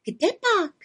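high little witch voice. A narrator's reading. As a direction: pipe it up like a young witch. Little witch answers raven.

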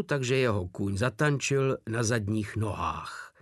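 ordinary delivery

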